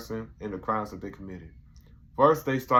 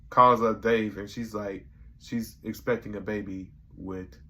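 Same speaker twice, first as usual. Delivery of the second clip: ordinary talk, nothing marked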